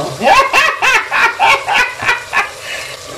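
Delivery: high, long laugh. Real, not acted. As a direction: let a long laugh roll out. Laughing after quoting the girl.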